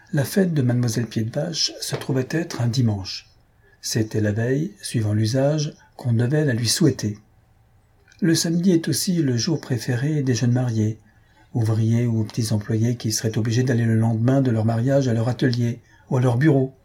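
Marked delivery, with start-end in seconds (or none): none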